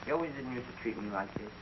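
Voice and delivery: very low, soft mumble; mumbling. Acted. Private complains to sergeant who was his friend, mumbling. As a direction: grumble it under your breath. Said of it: "Oh, stop mumbling".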